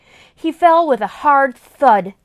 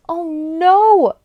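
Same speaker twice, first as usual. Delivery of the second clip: worried; 4 semitones higher, 5 dB louder than usual. Acted. Fears friend eaten.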